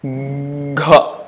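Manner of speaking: gh voiced with a push of breath; breathy voiced gh. Shown as a demonstration. Teacher demonstrating aspirated gh. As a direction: force air through gh. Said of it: "with the force of air".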